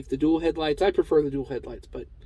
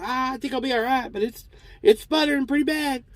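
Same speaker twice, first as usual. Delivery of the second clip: put-on much higher Cotton Hill-style old-man voice; [name] as Cotton Hill; 9 semitones higher than usual. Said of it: "apparently I'm gonna do it".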